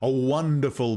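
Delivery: ordinary delivery, nothing marked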